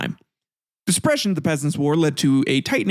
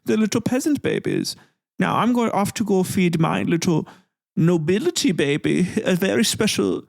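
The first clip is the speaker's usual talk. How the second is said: higher, sneering fake-German aristocrat; mock German noble; 5 semitones higher than usual. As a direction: taunt the poor as a hammed-up German noble. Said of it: "be a little German noble asshole".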